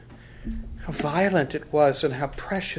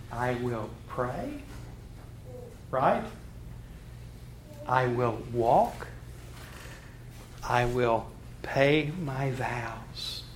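slow recitation; 4 semitones lower, 5 dB quieter, slower than usual. Shown as a demonstration. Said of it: "Let's say it together".

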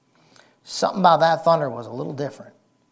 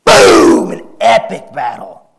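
thunder boom; 13 dB louder, slower than usual. Retold or imitated. Preacher imitating thunder clash.

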